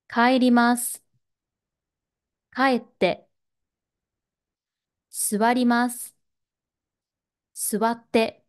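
clear, measured model reading with a pause after each verb; careful drill reading. Shown as a demonstration. Teacher models te-form verbs for learners to practise, clear and careful. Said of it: "手形の発音を練習したい".

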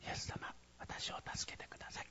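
very soft whispered prayer; barely audible whispered appeal; hushed plea. Shown as a demonstration. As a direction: whisper a timid plea for help, as the wrong way to pray. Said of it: "じゃなくて".

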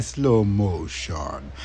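low, very slow drawn-out voice; slow motion. Pretend. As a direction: stretch every syllable like slowed tape. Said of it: "I'm gonna have to talk in slow motion too".

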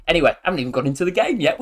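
usual speaking voice

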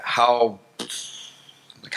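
usual speaking voice

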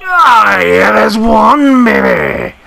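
high, loud, slow cheer; ecstatic shout. Real, not acted. Gamer celebrating a goal, loud high cheer. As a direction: let the whole room hear it.

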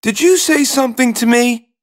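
high-pitched princess, questioning; high storybook princess voice; princess character. A narrator's reading. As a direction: ask in a high, incredulous princess voice. Princess startled by talking frog.

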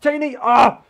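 very loud, high yell into a scream; explosive high outburst; sudden shout. Real, not acted. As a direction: burst out yelling at full voice. Sudden outburst during the game.

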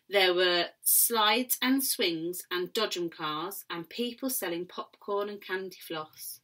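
usual speaking voice

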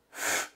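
"f" as a sustained consonant alone; isolated hissed "f" sound; bare F onset. Shown as a demonstration. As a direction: blow out just the "f", no vowel before it. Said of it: "já vem com F".

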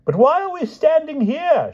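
very high, loud excited question; shouting. A narrator's reading. King questions the waiting, shouting excitedly. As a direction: shout with giddy impatience. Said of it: "shouted the king".

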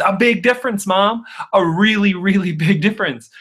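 high-pitched, acted exasperation; emphatic, mortified correction, played for laughs; exasperated retort.

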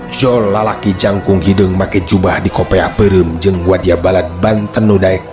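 ordinary delivery

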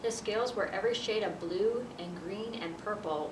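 plain reading voice

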